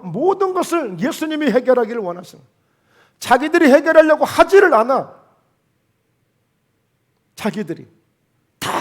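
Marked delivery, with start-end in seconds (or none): 3.2-5.2 preaching outburst. Real, not acted. Preacher rebukes disciples.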